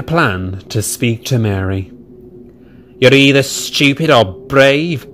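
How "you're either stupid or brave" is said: grunting James. Acted. James scoffing at Edward's plan, gruff. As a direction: grunt it out scornfully. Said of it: "grunted James".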